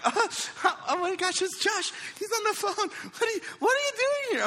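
very high giddy shocked voice; squealing girlfriend. Retold or imitated. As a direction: mimic her flustered squeal on the phone. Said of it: "she's like".

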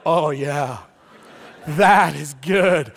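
low, slow, savoring approval; smug satisfaction. Retold or imitated. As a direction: relish the payback. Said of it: "Most of us were like".

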